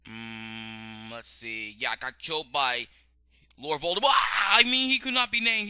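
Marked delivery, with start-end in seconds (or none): 4.0-5.7 quick, loud flustered correction. Real, not acted. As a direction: blurt the correction in a rush. Catching her own slip.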